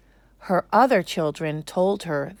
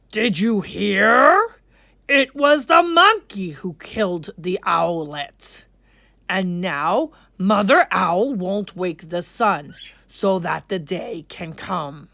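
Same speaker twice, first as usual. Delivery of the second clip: louder, booming lion king; king's voice; 6 dB louder than usual. Acted. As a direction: announce it like a ruler. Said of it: "The king said to the gathered animals".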